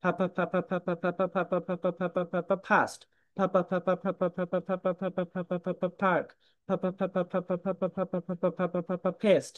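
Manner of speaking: rapid ফা ফা leading into ফাস্ট with a breathy p; aspirated drill. Shown as a demonstration. Teacher demonstrating the drill for the class. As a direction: chant ফা over and over then land on ফেস্ট. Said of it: "কিভাবে?".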